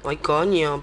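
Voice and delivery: usual speaking voice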